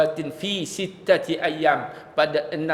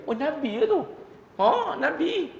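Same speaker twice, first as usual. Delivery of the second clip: surprised realization; 11 semitones higher than usual. Retold or imitated. Woman realizes it was the Prophet.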